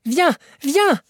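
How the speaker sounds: high, loud eager summons; urgent excited call. A narrator's reading. Uncle urges Axel to come see, high excited call. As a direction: wave him over with breathless joy.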